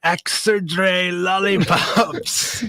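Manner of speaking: slow, drawn-out mock Scottish "Exerdre lollipops"; hammed-up Scottish reading. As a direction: read "Exerdre lollipops" slowly in a put-on Scottish voice. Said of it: "Is that a Scottish accent".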